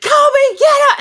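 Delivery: high, piercing yell; screamed. Retold or imitated. Calling to Colby who can't hear, screamed. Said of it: "And I have to scream it".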